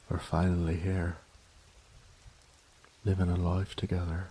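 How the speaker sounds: normal talking voice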